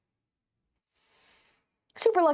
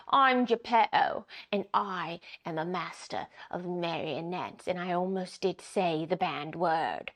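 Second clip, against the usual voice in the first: put-on slow Cockney villain accent; 5 semitones lower, 5 dB quieter than usual. Game player trying a voice for villain Geppetto, slow Cockney. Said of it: "still most Americans' go-to villain accent".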